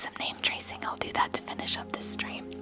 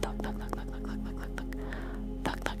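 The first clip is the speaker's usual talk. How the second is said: whispered thunk thunk like rotor blades; helicopter sound; 4 dB quieter than usual. Shown as a demonstration. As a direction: chop out a steady helicopter rhythm. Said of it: "I sound like a helicopter".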